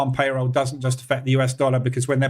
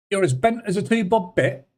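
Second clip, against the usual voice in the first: a viewer's phrase in a put-on higher English accent; 7 semitones higher than usual. Reading a viewer's request in an English accent. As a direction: ham up the English accent on this old saying. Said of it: "You just wanted me to say that in an English accent".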